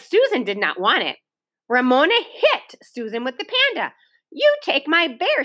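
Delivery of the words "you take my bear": mild order. A narrator's reading. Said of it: "she ordered".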